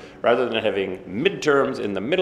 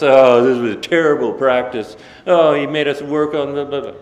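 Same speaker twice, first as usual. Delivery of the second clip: mock complaining; 5 semitones higher, 9 dB louder than usual. Retold complaint after practice.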